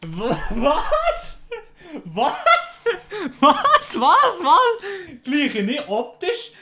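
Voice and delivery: loud high laughing what; shocked laughing disbelief. Real, not acted. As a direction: cry out in stunned disbelief. Shocked at dad comparison, laughing.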